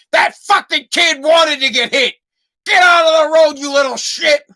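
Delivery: high, loud imitated old-man yell. Old man yelling at kid in road, hollered imitation. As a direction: holler it out of a car window, imitating him. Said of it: "He yells out the window".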